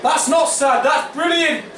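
excited shout. Real, not acted. Rebuts the sad comment.

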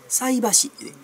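菜箸 with the pitch lifted high; 菜箸 sung up in the standard pattern; 菜箸 pitched high as the correct form; approved intonation. Shown as a demonstration. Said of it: "菜箸じゃないらしいね".

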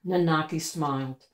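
usual speaking voice

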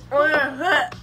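normal talking voice

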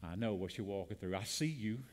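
soft, low and tender reassurance; Jesus speaking tenderly. Retold or imitated. Jesus comforting a struggling believer, softly and tenderly. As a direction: gently comfort the suffering listener. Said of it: "He'd say to you today".

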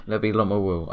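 normal talking voice

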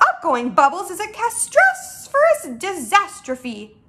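slow, dramatic pronouncement; dismayed cry. Acted. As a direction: lament it as an utter calamity. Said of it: "cried the B F G".

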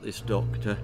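ordinary delivery, nothing marked

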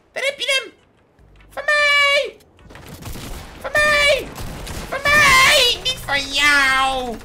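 very high, loud possessive yells; grabbing loot, shrieking mine; triumphant shriek. Real, not acted. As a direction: scream it is yours. Grabbing the weapon, yelling.

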